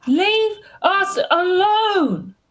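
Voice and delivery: high, drawn-out character yell at moderate volume; pent-up yell. Acted. Nim bursting out at the swarm, a high-pitched yell. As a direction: burst out high, stretching every word. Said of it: "he just yells".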